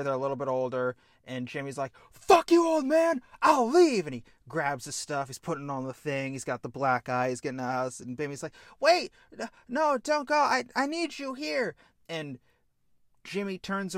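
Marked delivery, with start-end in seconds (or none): 2.1-4.1 put-on high, loud yell at the old man; furious shout. Older brother leaving home, yelling furiously at dad. Son storms out on father. 8.8-11.7 high, loud begging him not to leave; cling to him with the words; desperate pleading. Acted. Little brother begging Jimmy to stay, high pleading.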